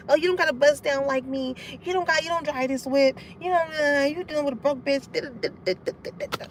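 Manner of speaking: imitating a rival's petty bragging, trailing off softly; put-on mocking voice that peters out; mock nitpicking. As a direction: mimic salty rivals, fading into babble. Mimics salty rivals nitpicking.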